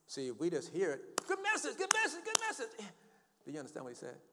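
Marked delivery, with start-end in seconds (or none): 1.3-2.7 high, loud mock gushing; mock congregation praise. Mocking shallow hearers praising a sermon.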